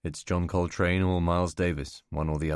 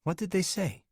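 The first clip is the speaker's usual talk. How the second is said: higher, anxious woman asking; concerned woman's voice; 11 semitones higher than usual. Acted. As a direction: press gently for details. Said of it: "Catherine voiced on phone".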